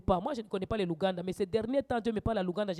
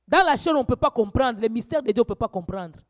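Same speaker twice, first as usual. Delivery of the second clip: preaching at full force; 3 semitones higher, 9 dB louder than usual. Acted. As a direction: rouse the congregation, voice climbing. Preaching rises again.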